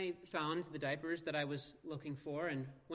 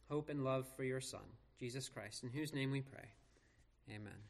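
subdued, lower prayer voice; public prayer; 3 semitones lower, 3 dB quieter than usual. Real, not acted. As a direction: pray reverently, settling softly onto the amen. Leads congregation in prayer.

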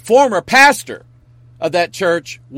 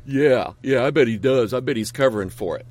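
low, soft aside of disbelief; imitated knowing, dismissive mumble; skeptical onlooker; 6 semitones lower, 5 dB quieter, faster than usual. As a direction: play a doubter muttering under their breath. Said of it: "somebody out there going".

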